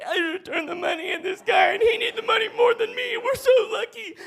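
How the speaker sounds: very high-pitched hysterical wail; hammed-up sobbing outburst to a partner; very upset. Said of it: "I am just so upset".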